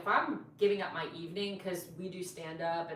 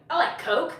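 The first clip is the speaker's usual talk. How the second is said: imitating the date; 10 semitones higher, 11 dB louder than usual. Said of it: "And he's like".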